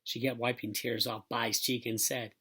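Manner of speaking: ordinary delivery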